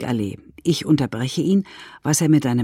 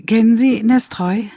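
hesitant question, pitched higher; timid, intimidated; 7 semitones higher, 4 dB louder than usual. A narrator's reading. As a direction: ask it tentatively after an "äh", voice up. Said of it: "schon sehr eingeschüchterte, zaghafte Frage". (zaghaft und eingeschüchtert)

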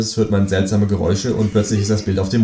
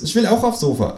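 high, cheeky kangaroo voice; high, sulky kangaroo; 10 semitones higher than usual. Acted. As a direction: play the kangaroo, much higher than the narrator. Kangaroo's voice in the story.

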